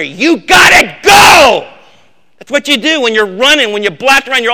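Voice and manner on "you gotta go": much higher, much louder, slow shout; a runner yelling as he hands off the baton; relay yell. Pretend. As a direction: bellow it to your running partner. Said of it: "you'll yell at your partner".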